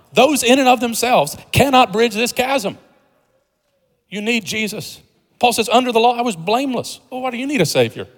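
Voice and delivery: high-pitched, emphatic preaching; impassioned preaching. Real, not acted. Preacher telling listeners they need a Savior, impassioned preaching. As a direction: drive the point home from the pulpit.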